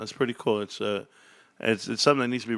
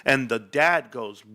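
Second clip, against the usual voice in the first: ordinary delivery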